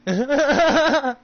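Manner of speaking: loud, high burst of laughter. Real, not acted. Gamer cracking up at his own threat, loud laugh. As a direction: let out a big cackle.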